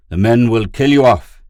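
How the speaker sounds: high, loud warning; scornful warning, no mirth; humorless scorn. A narrator's reading. Pid warns the deserters, humorless scorn. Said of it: "Pid laughed humorlessly".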